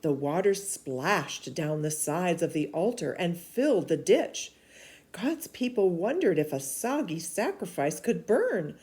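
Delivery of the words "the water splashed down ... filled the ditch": plain reading voice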